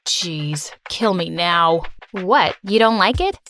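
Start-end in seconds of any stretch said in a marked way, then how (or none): 0.1-1.8 low, bored grumble; muttering. Acted. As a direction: groan it out like a fed-up teen. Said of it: "David muttered loudly".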